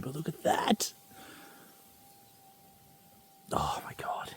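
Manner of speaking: voiceless whisper, wonder in it; awed whisper. Real, not acted. Whispering to viewers while showing off the nugget. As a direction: hush it, almost to yourself. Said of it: "I'm being really quiet".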